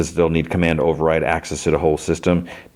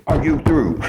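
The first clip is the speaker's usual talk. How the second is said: high, sharper imitation of a boss losing it; mock tantrum; 10 semitones higher than usual. Director cuts Tim off, imitated as a mock tantrum. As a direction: act out a petulant boss cutting him off. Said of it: "like a kid throwing a temper tantrum".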